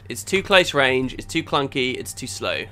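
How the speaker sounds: usual speaking voice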